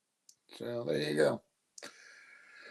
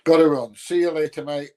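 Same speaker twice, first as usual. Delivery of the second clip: cheery call-out; 4 semitones higher, 9 dB louder than usual. Real, not acted. Signing off to a viewer.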